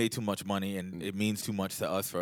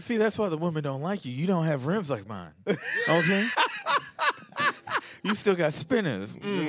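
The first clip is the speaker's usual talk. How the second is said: higher, teasing show-off voice; playful boastful roast; mocking brag; 8 semitones higher than usual. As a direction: taunt him about his rims.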